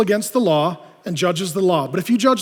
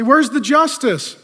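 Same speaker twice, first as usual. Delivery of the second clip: demanding; 7 semitones higher, 5 dB louder, slower than usual. Acted. Congregation call and response.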